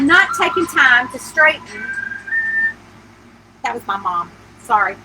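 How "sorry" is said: ordinary delivery, nothing marked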